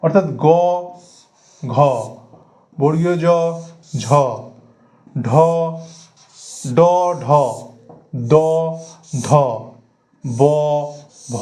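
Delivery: each voiced letter গ ঘ জ ঝ ড ঢ দ ধ ব ভ said alone with a buzzing throat; voiced letter demo. Shown as a demonstration. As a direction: spell out গ, ঘ, জ, ঝ, ড, ঢ, দ, ধ, ব, ভ one by one. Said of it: "উচ্চারণটা করুন জোরে জোরে".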